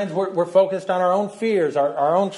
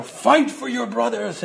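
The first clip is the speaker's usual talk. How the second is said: put-on a faint Irish accent, higher and slow; a half-hearted Irish accent, rallying the troops; failed Irish accent; 6 semitones higher than usual. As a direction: reach for an Irish brogue and fall short. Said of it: "like, an Irish accent when he says".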